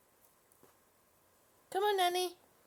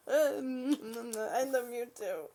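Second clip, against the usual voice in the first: slow, laughing through the words; much slower than usual. Real, not acted. Owner laughing while telling the dog she loves her. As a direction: let the laugh carry it, slowly.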